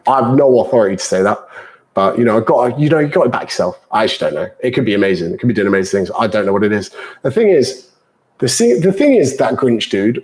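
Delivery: ordinary delivery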